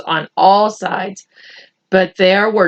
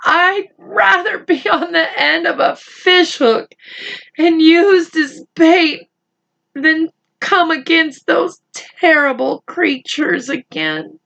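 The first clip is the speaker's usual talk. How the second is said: frightened; 7 semitones higher than usual. Acted. Frightened earthworm continues.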